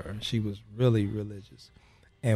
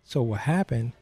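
normal talking voice

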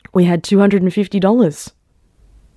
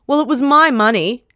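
higher-pitched, indignant self-justification; defensive retort; 8 semitones higher than usual. A narrator's reading. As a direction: defend yourself, pitch swooping high. Mother defends taking the money.

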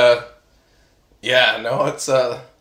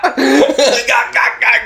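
high retching gah sounds; comic puking noise; gagging plant; 11 semitones higher, 4 dB louder, much slower than usual. Pretend. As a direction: gag like you drank too fast.

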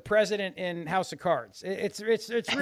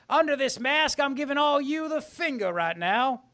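high, loud Southern politician gloat; hammy Foghorn Leghorn boasting; Southern accent; 5 semitones higher, 5 dB louder than usual. Pretend. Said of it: "It's my Southern accent".

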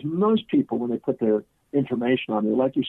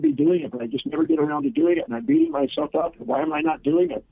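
higher, imitating a woman beating herself up; mock self-reproach, pitched higher; fretting client. Client retold, fretting about her rival.

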